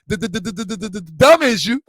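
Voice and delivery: imitating a stuttering uncle; mock stutter. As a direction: rattle the first sound, then punch out the phrase. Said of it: "my uncle stutter".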